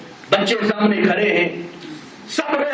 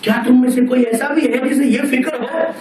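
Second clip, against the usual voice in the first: impassioned preaching; 6 semitones higher than usual. Acted. Preacher pleading with the congregation about the grave, impassioned rising sermon. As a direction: preach it higher and harder, pleading with the crowd. Climax of deathbed sermon story.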